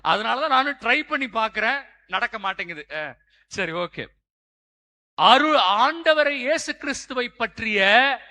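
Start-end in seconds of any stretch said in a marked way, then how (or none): 5.1-8.2 slow, loud, higher proclamation; forceful preaching. Real, not acted. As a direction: drive the teaching home phrase by phrase. (முழங்கும் பிரசங்கம்)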